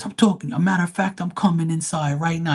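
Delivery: threatening customer. Retold or imitated. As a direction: play the guy escalating the threat.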